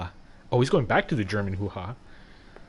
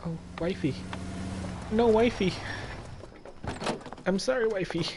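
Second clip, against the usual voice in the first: high, whiny mock apology; mock pleading husband; 9 semitones higher than usual. Mocking Cole begging his wife, high whiny plea. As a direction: whimper it like a guilty husband begging forgiveness.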